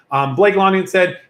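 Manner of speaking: ordinary talk, nothing marked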